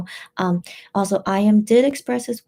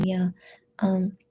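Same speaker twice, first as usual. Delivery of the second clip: ordinary delivery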